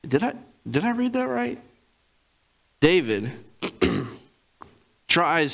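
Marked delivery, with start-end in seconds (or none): 0.1-1.6 high, mock stunned reread; pitched way up in shocked surprise; double-take disbelief. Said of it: "and it's just like".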